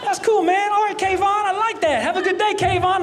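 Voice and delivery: high, friendly TSA agent, pitch way up; voice going up. Retold or imitated. TSA agent delighted by the name, voice jumping high. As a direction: lift the voice high, tickled by the name. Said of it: "his voice went up a little bit".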